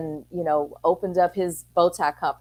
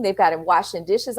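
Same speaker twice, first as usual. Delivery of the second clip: ordinary talk, nothing marked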